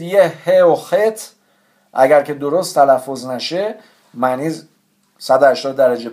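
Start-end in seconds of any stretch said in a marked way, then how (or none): none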